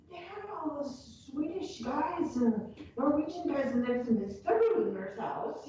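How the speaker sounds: high, slow, teasing expert voice; mock know-it-all. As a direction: play the local expert, pulling their leg. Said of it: "as if I knew what I was talking about".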